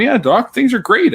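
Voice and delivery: high, loud patient telling the doctor all's great; cheerful joke patient. Acted. Patient reporting back to doctor after surgery, acted high and upbeat.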